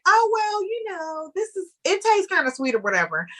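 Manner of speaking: high-pitched, airy novice reviewer; clueless beginner. Retold or imitated. Guest imitates her first wine reviews, clueless beginner. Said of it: "in the beginning, when I was just like".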